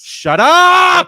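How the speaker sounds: very high, very loud drawn-out scream; exasperated yell. Pretend. Officer silencing a ranting arrestee, exasperated yell. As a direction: explode as a fed-up cop.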